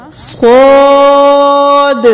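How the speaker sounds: صاد said full-mouthed and heavy, drawn out very slowly. Shown as a demonstration. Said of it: "اس کو صاد نہیں کرنا". (صاد موٹا اور کھینچ کر)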